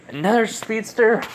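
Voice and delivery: high-pitched, astonished question; acted disbelief, voice leaping up; incredulous. Girl learns he is a speedster, high-pitched disbelief.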